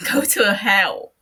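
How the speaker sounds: loud, slow, bitter; bitter retort. Acted. Matsuda telling L to go to hell, loud and bitter. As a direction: spit it out at him.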